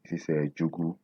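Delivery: usual speaking voice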